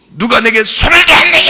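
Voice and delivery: loud, high, accusing shout; harsh demanding Jesus. Shown as a demonstration. Wrong way to voice Jesus, loud harsh shout. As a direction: bark the question like an angry master. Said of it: "이런 말투인 것 같아요?".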